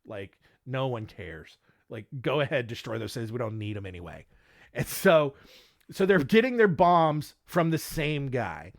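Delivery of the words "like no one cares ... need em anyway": indifferent. Retold or imitated. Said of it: "it's like".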